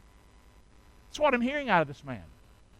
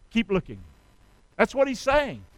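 normal talking voice

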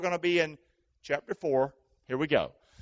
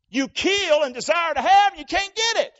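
fiery preaching; 13 semitones higher, 8 dB louder than usual. Acted. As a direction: thunder the scripture. Preaching scripture at full force.

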